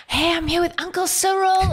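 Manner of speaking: high, bright-eyed schoolgirl voice; excited schoolgirl. Retold or imitated. Schoolgirl filming herself with the president, excited high voice. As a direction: squeal it like a fan filming. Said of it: "She's like".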